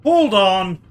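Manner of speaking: loud, startled exclamation; sudden shout. Acted. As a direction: burst in loudly to stop everyone. Sudden outburst in game.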